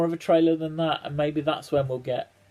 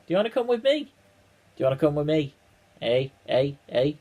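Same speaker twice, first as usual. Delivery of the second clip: light mock Scouse accent, rising pitch; a hammed-up Liverpool twang with a tag at the end; faster than usual. Vlogger imitating the Doctor in a Scouse accent. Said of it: "doing that Scouse accent".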